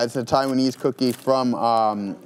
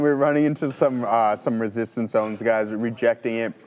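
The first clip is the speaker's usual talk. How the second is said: ordinary talk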